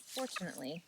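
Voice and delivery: ordinary talk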